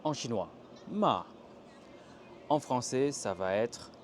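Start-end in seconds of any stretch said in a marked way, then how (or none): 0.9-1.3 MA falling firmly from high; commanding ma with a steep fall; fourth tone, authoritarian. Shown as a demonstration. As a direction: fire off ma like an order. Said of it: "très autoritaire".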